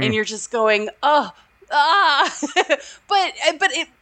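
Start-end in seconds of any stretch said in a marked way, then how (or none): 1.0-2.3 exasperated groan. Retold or imitated. Dreading a kid on flight.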